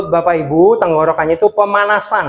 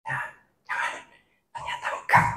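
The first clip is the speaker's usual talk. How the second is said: very soft, breath-only whisper; 15 dB quieter than usual. Shown as a demonstration. Speaker demonstrates whispering to preachers, hushed whisper. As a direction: whisper it for emphasis. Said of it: "lalu berbisik".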